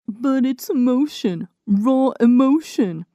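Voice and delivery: exaggerated earnest student voice; mock gushing classmate. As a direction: ham up the earnest writer insisting on emotion. Classmate gushes about emotion.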